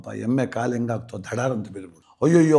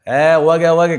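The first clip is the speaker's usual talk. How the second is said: dismissive brush-off; 3 semitones higher, 8 dB louder than usual. Retold or imitated. Husband brushes off wife.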